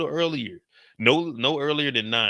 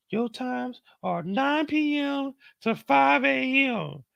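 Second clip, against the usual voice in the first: much higher, slow mocking voice; mock prissy reply; 11 semitones higher, much slower than usual. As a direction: parody her answer, stretched out. Mock voicing his schedule.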